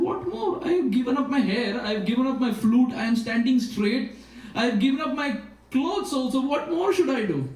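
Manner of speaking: put-on high, pleading voice of young Krishna; Krishna begging to meet Radharani, high and pleading. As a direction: beg in a high, desperate voice. Krishna pleading in retold story.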